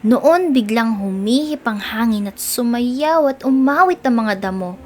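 ordinary delivery

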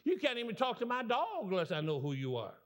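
high, emphatic mock warning; protective refusal. Pastor warning a suitor off, acted indignation. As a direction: play an indignant guardian laying down the law.

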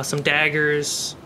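ordinary talk, nothing marked

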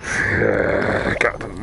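ordinary talk

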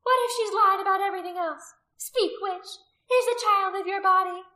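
high, loud demand; accusing, high-pitched challenge; fierce interrogation. A narrator's reading. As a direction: shout questions at a captive. Priestess interrogating a captive.

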